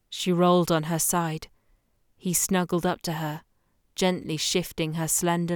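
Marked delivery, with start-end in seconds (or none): none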